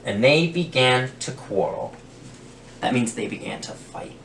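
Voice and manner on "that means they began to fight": hushed explanation to the listener; whispered aside. Real, not acted. Storyteller explaining to children, whispered. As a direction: lean in and whisper the meaning. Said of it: "aside to listener".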